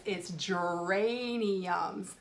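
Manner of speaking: geraniums drawn out very slowly; slow challenge word. Shown as a demonstration. Teacher presents her challenge word to kindergartners. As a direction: stretch geraniums out carefully. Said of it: "my big challenge word".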